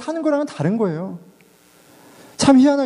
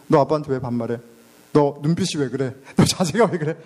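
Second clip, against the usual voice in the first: put-on lower, stern scolding of a child; lightly voiced strict dad correcting manners; stern father scolding; 6 semitones lower than usual. Father scolding his child for talking down, lower stern scolding. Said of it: "반말하면 그때그때 지적해".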